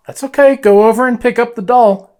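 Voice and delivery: high, loud coaxing call; talking to a ghost. Real, not acted. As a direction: coax an unseen spirit loudly. Calling out to spirit.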